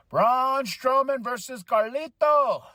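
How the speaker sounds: high, drawn-out match announcement; ring announcer. Acted. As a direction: stretch the names out like an announcer.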